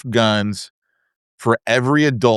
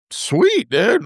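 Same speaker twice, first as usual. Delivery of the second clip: much higher, thrilled sweet dude; stoked; 11 semitones higher than usual. Retold or imitated. Hearing a buyer wants to make an offer, thrilled. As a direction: jump up in pitch with delight. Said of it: "I'm stoked".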